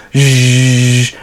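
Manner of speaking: long, steady buzzing hum; imitated buffer buzz. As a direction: buzz like a spinning floor brush. Imitates the buffing brush.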